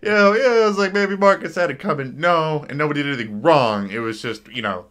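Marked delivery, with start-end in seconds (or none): 0.0-2.2 higher, louder mock quote; imitating dismissive others. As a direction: mimic the naysayers in a raised voice. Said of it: "'cause everybody's like".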